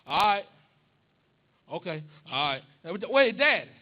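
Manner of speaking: loud, quick call back. Retold or imitated. Son calls out to dad on phone, loud. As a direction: catch him before he hangs up.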